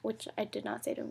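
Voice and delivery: usual speaking voice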